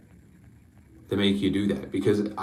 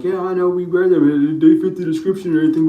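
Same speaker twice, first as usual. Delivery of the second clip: mocking quote; 7 semitones higher, 6 dB louder than usual.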